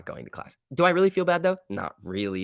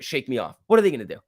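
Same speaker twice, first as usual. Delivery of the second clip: ordinary delivery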